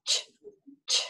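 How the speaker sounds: "Ch" as in church, no vowel; short voiceless "ch", repeated; isolated CH sound. Shown as a demonstration. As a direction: sound out a bare "ch". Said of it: "it's a C H sound".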